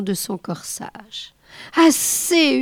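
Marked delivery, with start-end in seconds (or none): 1.8-2.6 « Assez » hurlé, aigu et fort; hurlement. A narrator's reading. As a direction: hurle « Assez » avec plus de force. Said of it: "hurla-t-il encore". (hurler « Assez » encore plus fort)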